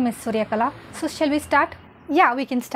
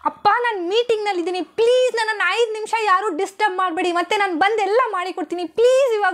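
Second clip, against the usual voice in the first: higher, louder desperate begging; frustrated pleading; 5 semitones higher, 5 dB louder than usual. Acted. Daughter pleading with father to stop disturbing her meeting, loud. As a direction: beg them all to leave her alone.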